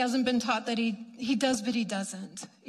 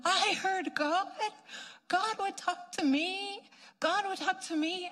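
overwhelmed believer; 7 semitones higher, 4 dB quieter than usual. Retold or imitated. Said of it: "they began bawling".

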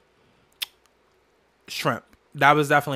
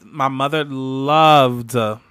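choking up; 3 semitones lower, 5 dB louder, much slower than usual. Real, not acted. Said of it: "oh, let me get emotional".

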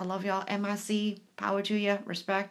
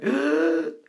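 sobbing gasp; 5 semitones lower, 6 dB louder than usual. Retold or imitated. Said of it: "crying and bawling my eyes out".

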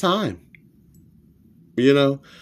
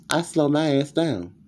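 usual speaking voice

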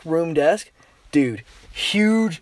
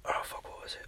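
breathy whispered aside to himself; 21 dB quieter than usual. Real, not acted. Telling the story, he whispers an aside while trying to remember. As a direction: drop to a whisper, forgetting the line.

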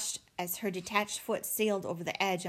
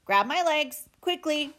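high, raised robot command; pressing, pitched-up order; urgent shout; 6 semitones higher, 4 dB louder than usual. A narrator's reading. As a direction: call out for immediate help. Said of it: "shouted Roz".

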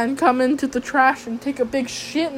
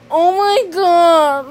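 mock dramatic gasp; 5 semitones higher, 4 dB louder, much slower than usual. Weird guy voiced.

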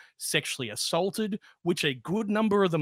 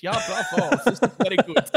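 mock German accent, a bit louder; Goebbels in German accent; 3 semitones higher, 5 dB louder than usual. Imitating Goebbels praising the rebrand, in a German accent. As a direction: ham up a German accent, pleased. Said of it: "Or maybe in a German accent".

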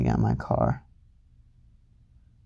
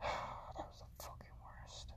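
whisper; 28 dB quieter, slower than usual. Real, not acted.

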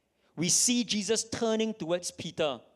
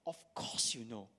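lower, hushed knowing rebuke; soft low reply; 7 semitones lower, 9 dB quieter than usual. Acted. Conscience answering Peter's third denial, low and hushed. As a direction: let it sink like a quiet verdict.

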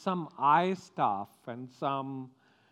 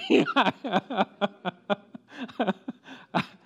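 loud, high, long burst of laughter; hearty laugh; 7 semitones higher, 8 dB louder than usual. Real, not acted. Pastor after his bad accent joke, hearty laughing.

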